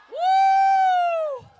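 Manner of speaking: high, loud rally whoop; excited whoop. Real, not acted. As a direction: whoop to fire up the crowd. Rally cheer from the speaker.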